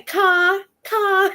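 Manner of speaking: high, loud teasing repeat of "Car" in a West Virginia drawl; mocking his accent. Locals teasing the New Yorker's accent, loud and high. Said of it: "And they'd say".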